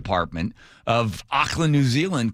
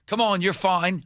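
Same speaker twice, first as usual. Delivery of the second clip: higher-pitched imitation of a coaxing guide; mock encouragement, pitched up; retold coaxing; 7 semitones higher than usual. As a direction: talk him into jumping.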